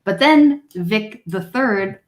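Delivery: ordinary talk, nothing marked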